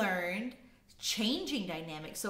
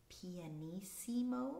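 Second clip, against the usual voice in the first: very soft pianissimo; 2 semitones lower, 10 dB quieter than usual. Shown as a demonstration. Teacher listing dynamics from quietest to loudest, very soft. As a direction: say the term softly. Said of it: "in order from quietest to loudest".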